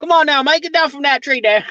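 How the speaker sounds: high Australian slipping into New York; mangled Australian-New York accent mix; botched Aussie accent. Pretend. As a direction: attempt Aussie and let New York creep in. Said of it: "a New York accent with an Aussie accent".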